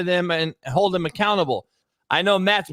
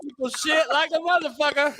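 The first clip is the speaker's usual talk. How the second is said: excited exclaim; 7 semitones higher than usual. Real, not acted. Laughing exclaim.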